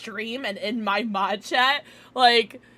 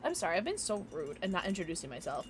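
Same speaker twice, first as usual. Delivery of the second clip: ordinary talk, nothing marked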